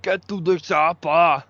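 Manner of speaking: badly faked Austrian action-hero accent; hammy Austrian tough-guy movie catchphrase; Arnold Schwarzenegger. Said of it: "that's my terrible Arnie impersonation".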